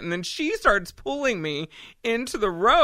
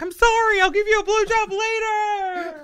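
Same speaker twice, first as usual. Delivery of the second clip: mock apology yell. To the stuck driver, a high laughing shouted apology. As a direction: yell a laughing promise out the car window. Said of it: "So I was like".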